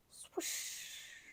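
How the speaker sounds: breathy swoosh sound; swoosh noise. Acted. Skaters gliding, a breathy swoosh. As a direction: make the sliding noise.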